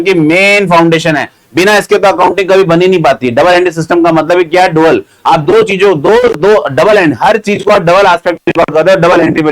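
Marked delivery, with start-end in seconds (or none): none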